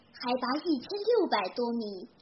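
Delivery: ordinary delivery, nothing marked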